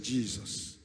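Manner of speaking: ordinary talk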